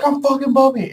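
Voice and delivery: very high, exasperated mock outburst; acted-out indignant retort; heated insistence. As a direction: insist at the top of your pitch. Beast man insists in quarrel.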